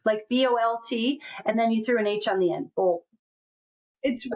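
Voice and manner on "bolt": "Bolt" said with an o like in bolt and an l before the th; 1 mimic "bolt". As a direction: imitate her "Bolt". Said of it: "sounds like you're saying bolt".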